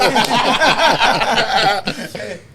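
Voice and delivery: loud, high real laugh; big laugh. Real, not acted. Telling friends the robbers ran, laughing loud. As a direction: laugh hard at the memory.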